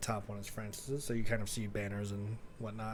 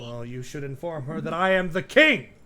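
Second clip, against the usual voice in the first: haughty king; 5 semitones higher, 11 dB louder than usual. Acted. King offended.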